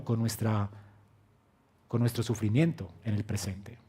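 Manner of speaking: ordinary talk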